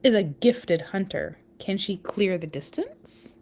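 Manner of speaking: lively read-aloud storytelling voice; story narration. Acted. Parent reading a spider book to listeners, storytelling voice.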